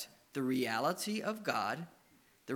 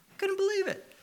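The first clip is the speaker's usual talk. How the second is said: squeaky falsetto of disbelief; high incredulous squeak. Real, not acted. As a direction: voice jumps way up in astonishment. Astonished at queue jumping.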